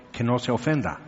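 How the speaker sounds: normal talking voice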